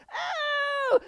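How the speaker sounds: very high, loud mock squeal of disgust; daughter's cry. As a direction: shriek like the startled girl. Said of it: "she was just like".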